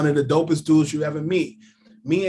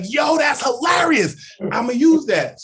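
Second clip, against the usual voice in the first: much higher and louder, slow and thrilled; delighted; 9 semitones higher, 5 dB louder than usual. Retold or imitated. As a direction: belt it out like you love the joke. Cuban delighted by the rhyme.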